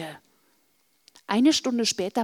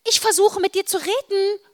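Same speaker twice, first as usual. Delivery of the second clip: insistent; 11 semitones higher, 5 dB louder than usual. Acted. Frustrated sister demands attention.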